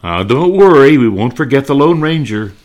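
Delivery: ordinary talk, nothing marked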